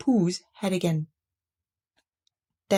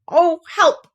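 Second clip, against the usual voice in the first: loud, higher call for help; distressed cry; 8 semitones higher, 6 dB louder than usual. A narrator's reading. Pooh stuck in the honey jar, distressed cry.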